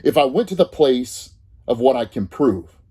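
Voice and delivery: normal talking voice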